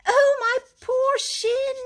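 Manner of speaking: high, slow whimper over the shin; a touch of pained wail. A narrator's reading. Mole moans to Rat about his shin, in pain. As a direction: moan about the hurt leg, drawn out. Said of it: "he cried".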